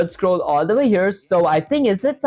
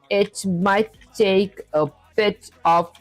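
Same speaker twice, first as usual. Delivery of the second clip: slowly spelling out a typed message; slow dictation; slower than usual. Real, not acted. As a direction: dictate slowly while typing. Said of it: "That said".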